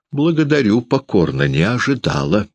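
high, falsely amused thanks; feigned laugh. A narrator's reading. Guest answering the insult, falsely amused. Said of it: "сказала с притворным смехом".

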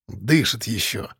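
officer grimly saying the suspect still breathes; gloomy. A narrator's reading. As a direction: report it grimly. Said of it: "мрачно сообщил Выдрин".

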